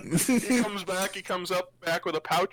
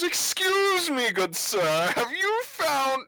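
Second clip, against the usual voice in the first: Nightblood; 6 semitones higher than usual. Acted. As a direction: ask politely in a swooping, singsong voice. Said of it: "And Nightblood says to the".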